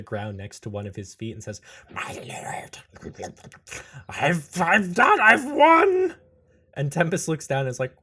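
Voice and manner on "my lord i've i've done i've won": strained whisper building to a high shout; triumphant frog creature. Acted. Handcuffed frog crawls to Tempest's feet, breathless triumph bursting out.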